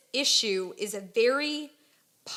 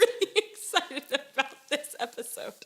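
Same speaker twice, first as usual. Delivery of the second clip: excited, laughing through the words; laughing with excitement; super excited; 3 semitones higher than usual. Real, not acted. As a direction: giggle while gushing about it. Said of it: "She's super excited".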